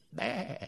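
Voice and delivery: high bleating baa; sheep bleat. Shown as a demonstration. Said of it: "That was Lancashire sheep counting".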